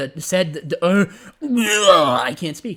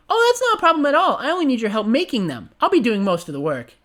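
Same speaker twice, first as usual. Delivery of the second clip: high, gleeful pony voice; gleeful Pinkie; 8 semitones higher, 5 dB louder, faster than usual. Acted. Pinkie reassures her late friend, high and gleeful. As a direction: beam out every word, high and giddy.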